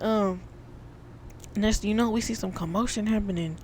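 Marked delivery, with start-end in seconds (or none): none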